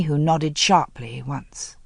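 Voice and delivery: ordinary talk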